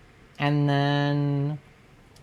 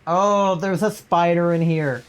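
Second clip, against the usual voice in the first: ordinary talk, nothing marked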